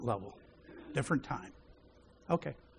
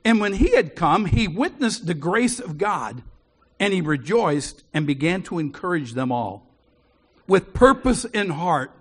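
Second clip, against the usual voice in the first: louder, measured Bible reading; scripture reading; 6 dB louder than usual. Acted. Preacher reading Acts to the church, loud and measured. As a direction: proclaim the passage to the congregation.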